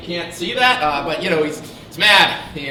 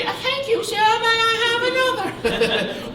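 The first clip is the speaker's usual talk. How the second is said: much higher, slow, sing-song voice; silly high voice. Acted. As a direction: voice the golfer in a goofy falsetto. Voicing golfer in a put-on voice.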